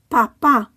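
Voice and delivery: Papá with the final PÁ stressed; stress on accented syllable. Shown as a demonstration. Teacher modelling written accents, Papá stressed at the end. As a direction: say Papá in Spanish, pa-PÁ. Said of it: "stress the syllable that the accent is on".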